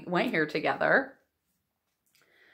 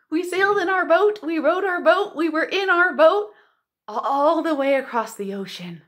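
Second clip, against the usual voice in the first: excited pretend voyage; 7 semitones higher, 4 dB louder than usual.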